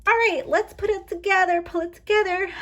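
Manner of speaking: high, loud rallying voice; self pep talk. Real, not acted. Emotional vlogger telling herself to pull it together, high and rallying. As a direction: shake off the emotion, loudly urging yourself on.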